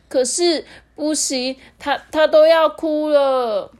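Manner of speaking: high, slow wailing narration; near tears. A narrator's reading. Narrator tells that Little Lemon can't speak, tearful. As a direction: stretch the words as if about to cry.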